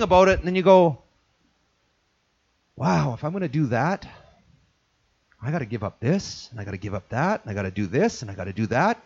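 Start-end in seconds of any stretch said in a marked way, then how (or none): 2.8-9.0 low, soft, hesitant inner voice; mild reluctant murmur. Retold or imitated. Churchgoer talking himself out of commitment, low and soft.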